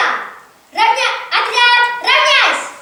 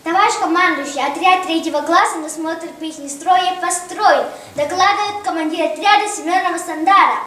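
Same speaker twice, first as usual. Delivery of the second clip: formal military report; 6 semitones lower than usual. Real, not acted. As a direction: report to the commander.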